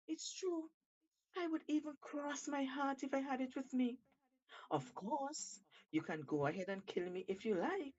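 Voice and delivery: a hint of a crafty monkey; sly Monkey. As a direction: coax the shark with mock regret. Monkey persuading Shark.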